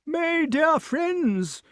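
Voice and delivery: very high, slow king's call; high-pitched, drawn-out cry; shouting old king. A narrator's reading. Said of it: "shouted King Pellinore".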